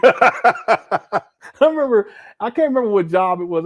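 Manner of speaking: talking through high, loud laughter; laughing. Real, not acted. Amused host to viewers, laughing loudly. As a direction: laugh the words out as the story starts. Laughing while starting a story.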